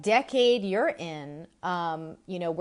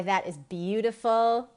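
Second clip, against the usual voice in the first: normal talking voice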